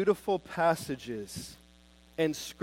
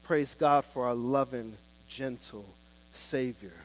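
tender praise; 6 semitones lower, 3 dB quieter than usual. Real, not acted.

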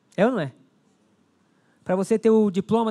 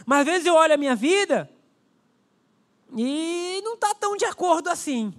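higher, impassioned preacher voice; fervent preaching; 5 semitones higher than usual. Acted. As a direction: plead it out to the congregation. Preaching at full force.

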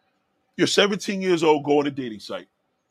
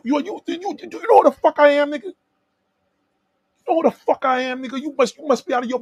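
angry shout; 11 semitones higher, 6 dB louder than usual. Real, not acted. Streamer yelling at a rude chat commenter, furious high shout. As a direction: pitch jumps high and loud, stammering into a yell.

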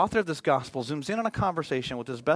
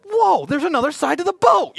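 hammed-up, high and loud discovery; goofy fisherman suddenly amazed; mock amazement; 13 semitones higher, 9 dB louder than usual. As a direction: act dumbly astonished at the obvious. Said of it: "they were just like".

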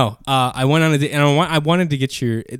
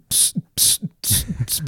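imitated music noise; 4 semitones higher, 7 dB quieter, slower than usual. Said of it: "It was like".

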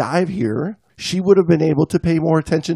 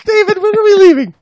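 high falsetto whining complaint; whiny wife voice; 5 dB louder than usual. Acted. Wife complaining to her husband about the cold, high falsetto whine.

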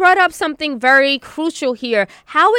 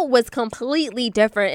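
normal talking voice

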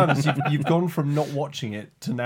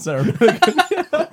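delighted echo; 14 semitones higher, 5 dB louder, slower than usual. Real, not acted. Echoes the pun, delighted.

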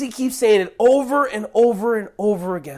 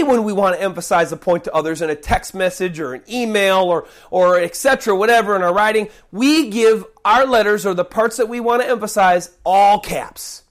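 ordinary talk

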